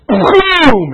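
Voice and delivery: loud, high explosive boom; vocal gunshot. Acted. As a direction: blast out a gun sound. Imitates gunshot.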